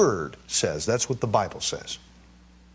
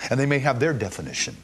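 usual speaking voice

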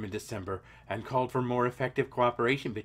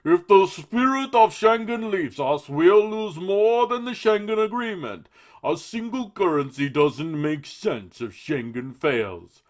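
much higher, louder cartoon politician; silly Juncker voice; 8 semitones higher, 11 dB louder than usual. Host reads the EU president's quote in a silly voice. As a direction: lampoon him with a goofy pompous voice. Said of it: "I'm gonna just make him sound silly".

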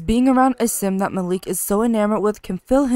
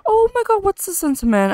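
gasping surprise; 11 semitones higher than usual. Real, not acted. Gasps at new sentiment.

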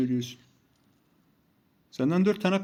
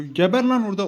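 loud, higher-pitched jeer; gloating outburst; 5 semitones higher, 5 dB louder than usual. Real, not acted. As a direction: burst out at the dying enemy. Exulting over enemy's death in game. (bağırarak, keyifle sövme)

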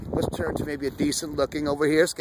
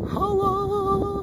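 long high falsetto holler. Real, not acted. Vlogger hollers happily at the sky. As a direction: sing it out high and hold it.

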